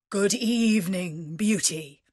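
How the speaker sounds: gruff beast's formal greeting. A narrator's reading. Beast greeting Beauty after supper, gruff. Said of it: "said in a gruff voice".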